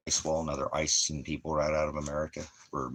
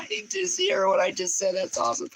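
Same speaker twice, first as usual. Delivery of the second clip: laughing; 6 dB louder than usual. Real, not acted. Laughing at his own Clinton joke.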